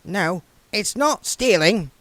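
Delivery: the dwarf reassuring William on the cart; emphatic dwarf. Acted. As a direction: Goodmountain insisting it isn't stealing. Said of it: "said Goodmountain emphatically".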